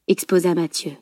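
ordinary delivery, nothing marked

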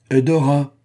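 menacing cry. A narrator's reading. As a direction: bark the servant's name as a warning. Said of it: "cria-t-elle d'une voix menaçante".

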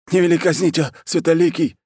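high-pitched frantic grovelling; hysterical begging. Acted. War chief begs khan to spare him, hysterical high plea. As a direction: plead for your life, very high. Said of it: "Снова забился в истерике военачальник".

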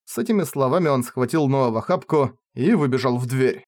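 ordinary delivery

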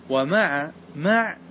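مع said with a silent, unvowelled ain, slowly and with weight; sukun on the ain. Shown as a demonstration. Teacher to students, demonstrating the rare silent ain. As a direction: stretch out مع and hold the ain without a vowel. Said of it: "يعني إسكان العين في كلمة مع".

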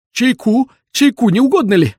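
high, loud, eager offer; fussing old man. A narrator's reading. Old man nudges Bender indoors, high fussing voice. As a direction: fuss over the guest in a high voice. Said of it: "бормотал старик".

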